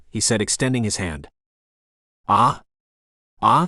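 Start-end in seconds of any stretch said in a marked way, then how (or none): 2.3-3.7 two startled, hesitant "Ah?"s; nervous stammer. A narrator's reading. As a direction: caught off guard, stumbling for words. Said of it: "the kid stammered".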